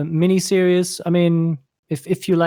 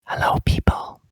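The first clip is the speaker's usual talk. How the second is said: whisper. Pretend. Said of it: "This is something for the outtakes".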